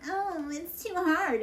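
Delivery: high, slow, whiny mock of a quitter; a whiny mock "oh, it's too hard"; mocking whine. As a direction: sneer it out like a sulky complainer. Mocking people who give up.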